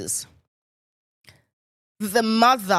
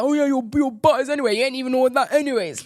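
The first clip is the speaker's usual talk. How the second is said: fast, raised mock-male taunt; quick, higher mimicked jeer; mocking men's banter; 4 semitones higher, 3 dB louder, much faster than usual. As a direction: rattle it off, ridiculing boastful boys. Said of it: "Men love doing that, don't they?".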